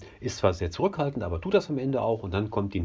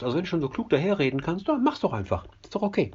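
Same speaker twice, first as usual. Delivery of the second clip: quick, higher snappy comeback; irritated ferryman retort; 7 semitones higher, faster than usual. Acted. Ferryman snapping back at the smug bystanders, quick and high. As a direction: throw it back at him fast.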